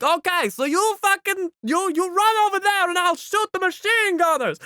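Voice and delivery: high, loud hurried battle instructions; urgent sniper orders. Retold or imitated. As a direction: tell him the plan over the gunfire. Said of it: "he's just like".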